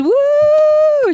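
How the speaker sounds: high, drawn-out excited woo. Real, not acted. Co-host cheers the new team member, excited and slow. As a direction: let out a long cheer. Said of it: "So excited to have you".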